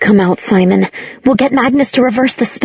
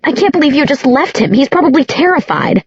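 high, heated accusation; high-pitched reproach; upset reproach; 7 semitones higher than usual. A narrator's reading. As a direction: scold them, appalled. Clary quarrels with Isabel.

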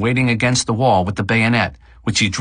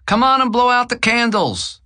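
from another room; 14 semitones higher than usual. A narrator's reading. Relative calling from other room.

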